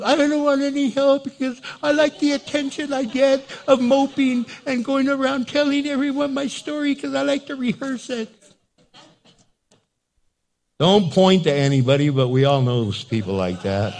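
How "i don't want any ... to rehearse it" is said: high, put-on whine; mock attention-seeking mope; moping. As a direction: refuse help in a hammed-up pitiful wail. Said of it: "Moping is".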